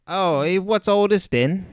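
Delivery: hammy fake Cockney accent; mock London bobby Cockney accent; bad Cockney accent. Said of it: "our really bad Cockney accents".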